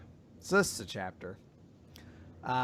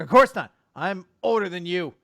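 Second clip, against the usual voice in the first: higher, louder protest; mock flustered denial; 6 semitones higher, 12 dB louder than usual. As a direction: insist it, lightly acting offended. Girl denies being shy.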